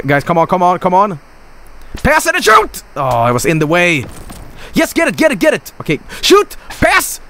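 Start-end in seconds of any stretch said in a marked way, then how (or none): 2.0-2.8 high-pitched frantic command; desperate gamer cry; urgent shout. Real, not acted. Yelling orders at players. 4.7-7.2 high, urgent shouted orders; desperate, excited gamer shouting; frantic urging. Real, not acted. Frantic push toward goal.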